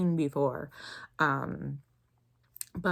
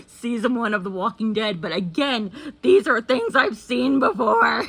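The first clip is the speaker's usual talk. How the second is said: loud protest climbing in pitch; exasperated outburst; 5 semitones higher, 9 dB louder than usual. Real, not acted. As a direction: build to a high, loud complaint. Protesting a repeat recommendation.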